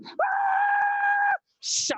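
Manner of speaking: very high, sustained mock scream; falling scream. Skydiver thrown out of the plane, long high scream. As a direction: let out a long piercing acted scream. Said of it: "And you're screaming".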